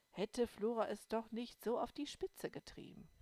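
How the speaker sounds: ordinary delivery, nothing marked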